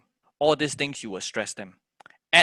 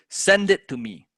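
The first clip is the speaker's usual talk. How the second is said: "Send" punched higher and louder; stress on SEND; 6 semitones higher, 5 dB louder than usual. Shown as a demonstration. Teacher demonstrating natural stress, hard on "Send". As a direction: lean on "Send", let the rest fall away. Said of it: "naturally stress on the word send".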